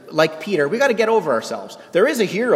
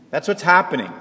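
ordinary delivery

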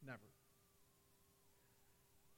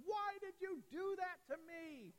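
put-on higher, slow teary child's voice; hurt little girl; 14 semitones higher, slower than usual. Father voicing his crying daughter, slow and higher. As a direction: play the daughter who just cried. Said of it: "and she said".

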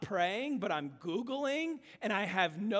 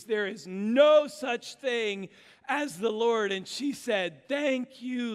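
loud, high-energy joke telling; big animated preacher storytelling; preaching a joke; 4 semitones higher, 5 dB louder than usual. Acted. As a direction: sell the corny joke to the room, full force. Tells joke at full preaching force.

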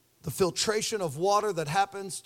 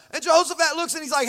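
normal talking voice

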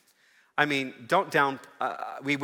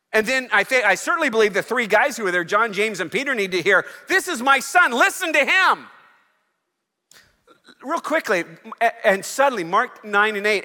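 high, loud, ringing preacher's call; preacher at full cry, pleading with the church; full-force preaching; 13 semitones higher, 11 dB louder than usual. Acted. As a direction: lift the whole room with it.

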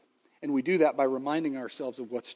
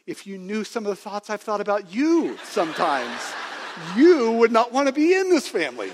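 rising to a loud, high comic protest; mock outburst; 6 semitones higher, 6 dB louder than usual. Father jokingly topping his son's confession, mock outburst. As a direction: build to a hammed-up exclamation on the second half.

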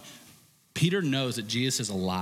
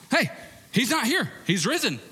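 excited news; 10 semitones higher than usual. Acted. Women running to tell disciples.

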